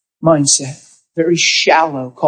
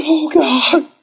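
tearful; 10 semitones higher than usual. Real, not acted. Pastor moved, crying.

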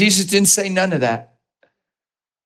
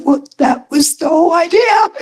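much higher, shouted punchline; joking shout; 12 semitones higher than usual. Retold or imitated. Preacher's retold joking reply.

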